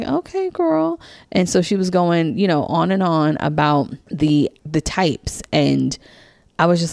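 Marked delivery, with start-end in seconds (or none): 0.0-1.0 much higher, drawn-out mock agreement; sarcastic okay. Answering friend's aura pitch, sarcastic high-pitched brush-off. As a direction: humor her with a sky-high eye-roll.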